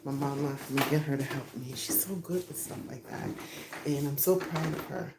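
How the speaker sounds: ordinary delivery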